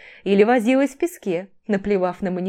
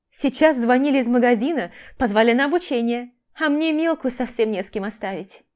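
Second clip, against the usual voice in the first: rattling off; 6 semitones higher, 3 dB louder than usual. A narrator's reading. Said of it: "затараторила Оля".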